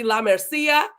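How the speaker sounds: "La Mercia" with Spanish vowels, MER as "mair"; Spanish-style "La Mercia", open "lah mair-SEE-ah"; Spanish accent. Shown as a demonstration. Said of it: "I will put a Spanish accent".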